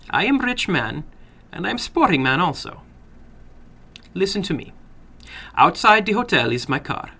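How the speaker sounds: put-on sly older gentleman with an accent; probing the boy, a touch sly; foreign little man. Narrator voices the little man.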